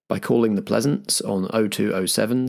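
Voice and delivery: usual speaking voice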